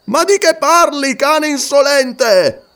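voce molto più alta, "cane" scandito lento; rimprovero rabbioso. Acted. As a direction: sgrida il servo insolente. Warrior corrects cowering porter angrily. (Umslopogaas sgrida il Wakwafi, furioso)

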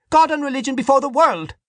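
very high-pitched zealous proclamation; fervent cry. A narrator's reading. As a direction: cry it out above everyone at the table. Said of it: "Dante cried".

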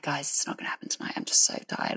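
whispered, worn-out complaint; sleepy whisper. Retold or imitated. Singer whispering to her band that she's too tired. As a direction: whisper it as if half asleep.

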